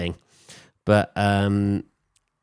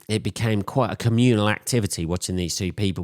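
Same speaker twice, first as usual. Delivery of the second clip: ordinary talk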